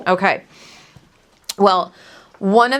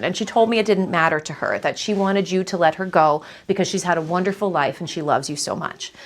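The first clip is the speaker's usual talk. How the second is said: ordinary delivery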